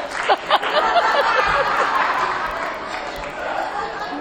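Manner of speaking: high, breathy laugh. Real, not acted. Sharing hope with the group, high breathy laugh.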